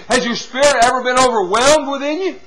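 normal talking voice

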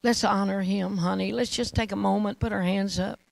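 reverent, with long awed pauses; hushed worship prayer. Real, not acted. Preacher invites listeners to honor God, hushed reverent prayer. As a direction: lead the room gently into worship, unhurried and subdued.

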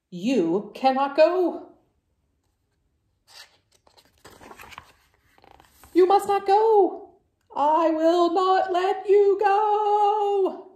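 loud, high, slow begging; desperate pleading. Acted. Gerald begs Piggy to stay, loud and wailing. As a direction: beg your friend not to go.

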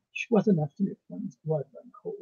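usual speaking voice

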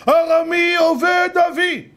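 slow, high-pitched loud recitation; shouting. Shown as a demonstration. Pilgrim shouting the verse to the priest, loud. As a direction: cry the verse out, stretching each word. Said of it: "תצעק".

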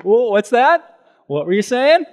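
loud, high playful fake surprise; grinning mock innocence. Jesus grinning at the magnetized Pharisees, loud mock surprise. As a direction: play dumb with a smug grin. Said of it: "would have grinned from the cross".

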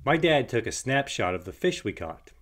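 usual speaking voice

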